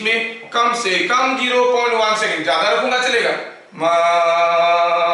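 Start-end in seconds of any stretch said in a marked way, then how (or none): 3.7-5.1 stretched out, slow Maharaja; खींचकर धीमा महाराजा. Shown as a demonstration. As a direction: drag each syllable of "महारा-" long and sung. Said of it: "महाराजा बोलूंगा तब भी सुनाई देगा". (हर अक्षर खींचकर, गाते हुए "महारा-")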